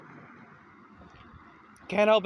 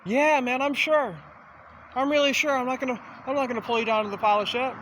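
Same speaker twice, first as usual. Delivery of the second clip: eager reassurance; 7 semitones higher, 4 dB louder than usual. Retold or imitated. Eager reassurance in an acted exchange.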